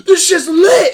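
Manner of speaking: excited yelled hype; hype shout. Retold or imitated. Kid on stage hyping the crowd, loud excited shout. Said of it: "and he goes".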